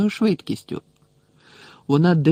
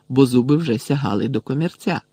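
ordinary delivery

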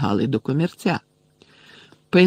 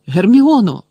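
high, loud alarmed shout; alarmed cry; 8 semitones higher, 9 dB louder than usual. A narrator's reading. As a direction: cry out her name in alarm. Ron rushing to hurt Hermione.

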